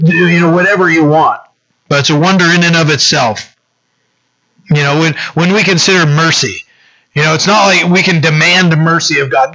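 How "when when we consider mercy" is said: ordinary delivery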